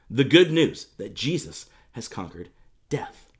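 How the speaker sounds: ordinary talk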